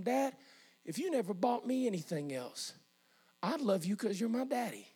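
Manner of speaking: a touch of tearfulness, hushed and tender; tearful, retold. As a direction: speak softly, as if tearing up. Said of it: "I remember tearing up right there and telling him".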